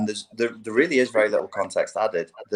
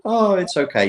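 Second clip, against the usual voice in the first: mock soothing; 10 semitones higher, 4 dB louder than usual. Mocking a numbed person.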